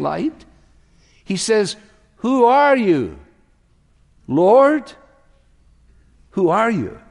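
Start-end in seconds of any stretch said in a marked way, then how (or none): 2.2-4.9 high, loud, drawn-out cry; awed question. Acted. Saul's answer to the shining light. 6.3-7.0 acted appeal, mildly raised pitch; awed question. Saul's question repeated by preacher.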